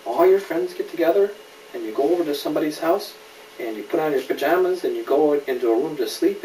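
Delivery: usual speaking voice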